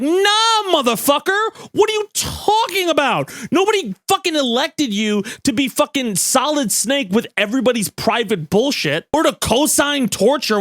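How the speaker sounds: high, indignant mock outburst; outraged public. Voicing the angry public at the government, a high indignant outburst. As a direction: yell it like the fed-up public calling out an official. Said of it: "they were like".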